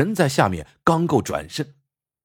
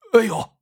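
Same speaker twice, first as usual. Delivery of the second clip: a hammed-up fake yelp; exaggerated yelp; 10 semitones higher than usual. A narrator's reading. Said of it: "秦继欧夸张地叫了一声". (夸张地高声假叫一声)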